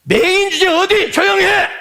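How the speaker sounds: high, loud contemptuous rebuke; rebuking. Acted. Crowd rebuking the beggar shouting for Jesus, contemptuous yell. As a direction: scold the beggar to shut him up. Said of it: "하고 꾸짖었어요".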